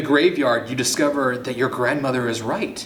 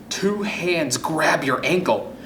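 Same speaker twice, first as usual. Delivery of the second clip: ordinary delivery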